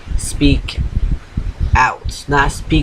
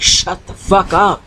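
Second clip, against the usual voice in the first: higher-pitched, drawn-out mock outburst; retold angry snap; 6 semitones higher than usual. Retelling her snap at the nonstop talker, imitated angry outburst. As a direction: re-enact snapping at him, stretching the words. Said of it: "and I just snapped".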